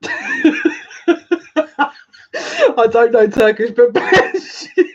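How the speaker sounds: high, laughing through the words; real laughing. Real, not acted. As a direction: break into laughter, stretching the last words. Laughs while blessing a viewer.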